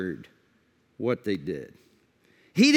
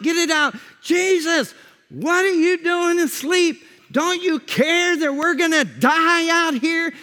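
urgent, higher-pitched shouts to a crew; panicked yelling; 8 semitones higher, 4 dB louder than usual. Acted. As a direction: yell out frantically over a storm. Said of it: "everybody's yelling".

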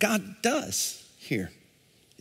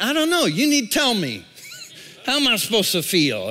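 exasperated reply; 7 semitones higher, faster than usual. Retold or imitated. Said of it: "And you're like".

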